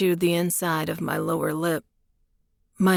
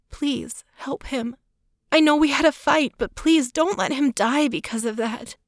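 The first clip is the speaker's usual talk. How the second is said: tearful pleading; 8 semitones higher than usual. A narrator's reading. Claire pleading in tears.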